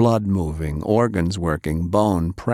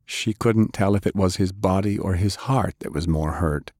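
plain reading voice

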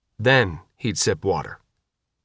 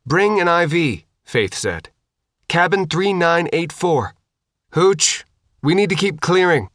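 brisk soldier; 6 semitones higher than usual. A narrator's reading. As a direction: bark it into the radio like a squad leader. Soldier giving radio orders.

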